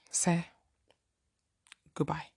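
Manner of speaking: unvoiced, dry whisper of goodbye; whispered dismissal. Real, not acted. Dismissing the guy.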